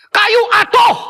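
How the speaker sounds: high, loud shout breaking into an ouch; warning cut by a cry of pain. Retold or imitated. Hiker shouting as a branch hits his head, high pained yell. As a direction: yell the warning, then get hit mid-shout. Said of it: "suara teriakannya begini".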